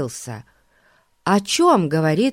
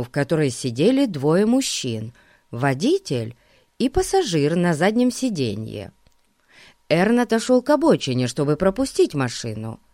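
plain reading voice

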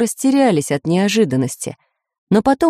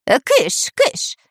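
higher-pitched shooing cry; shooing away; 7 semitones higher, much slower than usual. A narrator's reading. Dyrka waves the flying reptiles away, shooing. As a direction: shoo them off in short bursts. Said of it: "замахал руками шпион Дырка".

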